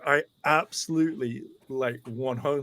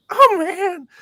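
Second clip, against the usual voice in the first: high, loud wail of letdown; disappointed fan impression; 9 dB louder than usual. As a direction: whine it way up high, crushed. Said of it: "this is literally how he's, uh, how he's speaking".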